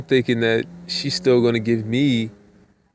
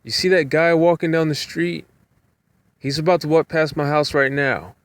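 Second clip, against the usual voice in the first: lightly voiced older lady, higher; a hint of the passenger's higher voice; 7 semitones higher than usual. Passenger telling the driver where she lives, lightly higher voice. Passenger on the phone giving directions.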